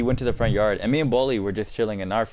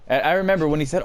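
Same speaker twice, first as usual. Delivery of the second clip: usual speaking voice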